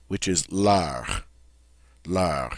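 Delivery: leur said slowly and low, open French vowel. Shown as a demonstration. Teacher modelling leur, a nice strong sound. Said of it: "Isn't that a nice, strong sound?".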